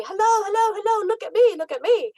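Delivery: high, loud sing-song calls for attention; chirpy bird greeting. Retold or imitated. A dead loved one in a bird at the feeder calls out, high and chirpy. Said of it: "and they wanna say".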